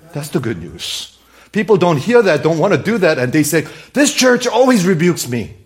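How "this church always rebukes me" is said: loud, high mock protest; indignant complaint. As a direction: complain like an affronted churchgoer. Voicing an indignant churchgoer's complaint.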